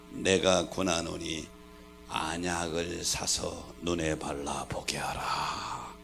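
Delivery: slow whispered scripture; hushed reading. Real, not acted. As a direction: breathe the verse slowly. Quoting Revelation verse softly.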